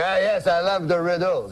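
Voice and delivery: put-on comic Frenchman uncle accent; French-Canadian accent. Uncle tells cab driver he loves riddles, French-Canadian accent. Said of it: "That's how he talks".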